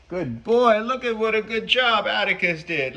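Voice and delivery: high, loud praise; gushing praise. Real, not acted. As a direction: praise him like a good little pet. Praising Atticus like a pet.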